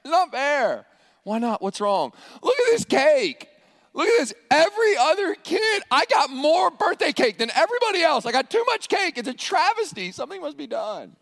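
high-pitched mock child's protest; hammed-up kid's whine of outrage, pitched high; complaining child. Child at a birthday party complaining about too much cake, mock-indignant. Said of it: "Has any child ever said at a birthday party".

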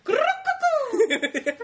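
very high, loud shrieked boom; cartoon sound effect.

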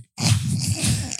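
high unvoiced giggle; high laugh. Real, not acted. Laugh alone on line.

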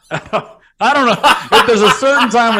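words breaking into giggles, slowed down; can't hold back the laugh while explaining; laughing through it. Real, not acted. Cracking up to his co-host mid-explanation, laughing.

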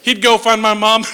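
ordinary talk

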